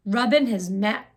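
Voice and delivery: ordinary delivery, nothing marked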